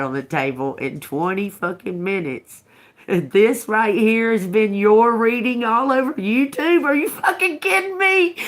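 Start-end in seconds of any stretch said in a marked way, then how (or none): 3.1-8.4 high, loud disbelief, half laughing; incredulous outburst. Real, not acted. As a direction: shriek it in disbelief. Outraged disbelief at a viewer.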